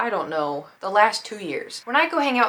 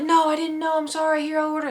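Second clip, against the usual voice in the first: friend apologizing; 7 semitones higher than usual. Retold or imitated. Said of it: "he's like".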